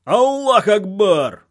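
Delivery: high, loud, drawn-out chant shout; long rousing war cry, pitched high; battle-cry yell. A narrator's reading. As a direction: yell it as a crowd of fighters would. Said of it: "снова заорали боевики".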